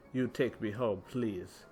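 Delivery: put-on low-pitched thick Russian accent. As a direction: imitate an elderly Russian woman's accent. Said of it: "she said in a thick Russian accent".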